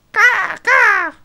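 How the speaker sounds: two high, blaring crow calls; loud double caw. Acted. Player voicing Russell the bird, loud cawing. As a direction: squawk twice like a crow.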